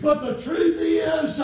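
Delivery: ordinary delivery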